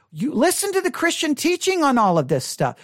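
usual speaking voice

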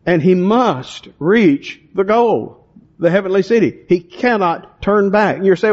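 ordinary talk, nothing marked

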